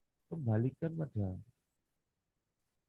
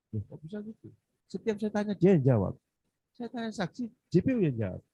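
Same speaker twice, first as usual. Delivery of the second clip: rising indignation, pushing the words out louder; exasperated retelling; 7 semitones higher, 6 dB louder than usual. Retold or imitated. Lawyer retelling how the prosecutor kept answering for the witness, exasperated. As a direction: let the outrage build as you tell it. Lawyer recounting courtroom frustration.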